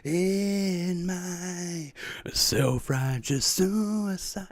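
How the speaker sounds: very slow, soft, drawn-out mock-tragic line; theatrical whisper. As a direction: hush it out dramatically. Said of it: "I'm trying to be quiet".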